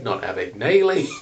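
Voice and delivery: "Abagnale" as "Abag-NAY-lee"; wrong pronunciation. Retold or imitated. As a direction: reject "Abagnale" said with a "-naylee" ending. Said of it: "No, he's like".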